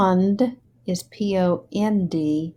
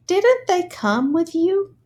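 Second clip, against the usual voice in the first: a clear question with a lifted end; rising question; 10 semitones higher than usual. Shown as a demonstration. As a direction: start high on Didn't, rise again on you. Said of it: "our voice is going up".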